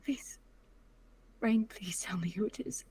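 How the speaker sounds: desperate, barely voiced begging; whispered pleading. Daughter begging her father for a name in a whisper.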